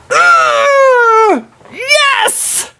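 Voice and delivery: high, loud victory shout; triumphant cry. Real, not acted. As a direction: celebrate the kill. Success after the hunt.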